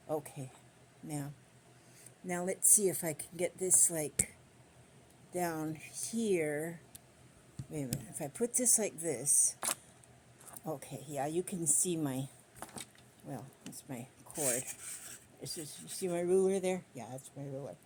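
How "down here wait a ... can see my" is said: ordinary delivery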